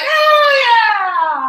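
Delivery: high, loud, excited cry of recognition; surprised shout. Retold or imitated. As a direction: shriek it like spotting an old friend. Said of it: "you'd be like".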